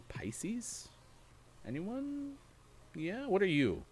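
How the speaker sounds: questioning. Real, not acted. Asks audience zodiac.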